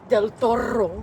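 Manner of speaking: Del Toro with an exaggerated trilled R; aggressively rolled R. Shown as a demonstration. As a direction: roll the R hard in Del Toro. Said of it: "That's too aggressive".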